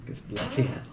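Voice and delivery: soft, slow Australian imitation; mock Aussie accent. Shown as a demonstration. Answering friend's accent attempt, in quiet mock Aussie. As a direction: try a low-key Aussie curse. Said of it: "You said Aussie".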